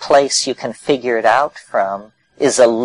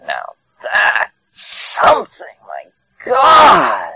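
delighted exclamation; 9 semitones higher, 4 dB louder, much slower than usual. Retold or imitated. Os beams and exclaims about DMT.

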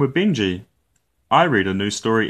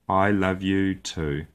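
soft, slow, loving reply; tender; 3 dB quieter, slower than usual. Acted. Daddy Bear answering his drowsy cub, tenderly. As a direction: say it gently, slowly, to a sleepy child. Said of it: "he said".

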